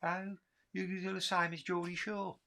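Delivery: high-pitched mock Brummie; Birmingham accent. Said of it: "talk to anybody in Birmingham, they go".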